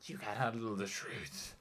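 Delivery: slow, softened movie-star impression; Jack Nicholson. Crocodile breaking out in court, slow Hollywood impression. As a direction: growl out the courtroom line slowly. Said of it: "crocodile Jack Nicholson breaks out".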